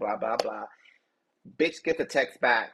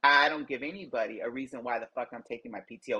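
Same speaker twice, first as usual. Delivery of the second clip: ordinary delivery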